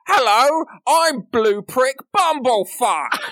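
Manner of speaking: the mimic greets Glubrick, high, loud and drawn out. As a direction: boom it out like a grinning mimic. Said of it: "the mimic just says".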